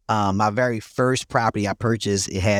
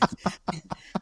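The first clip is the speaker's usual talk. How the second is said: breathy laugh; 8 semitones higher, 11 dB quieter than usual. Real, not acted. Laughing at his own story.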